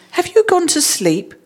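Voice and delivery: high-pitched wifely reproach; indignant exclamation. A narrator's reading. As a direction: exclaim the question, pitched well up. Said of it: "she exclaimed".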